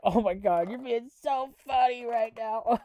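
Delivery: high, hammed-up fawning voice; mock a fan who thinks he's hilarious; sarcastic high-pitched gushing. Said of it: "And you're".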